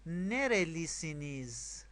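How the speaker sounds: slow, drawn-out model phrase; repeat-after-me model. Shown as a demonstration. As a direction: stretch it slowly for learners to copy. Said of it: "پشت سر من تکرار کنید".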